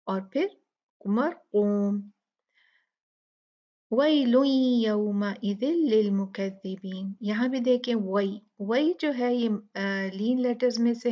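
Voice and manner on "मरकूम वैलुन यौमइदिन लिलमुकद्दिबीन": melodic recitation of the verse; tajweed recitation; Quran recitation. Acted.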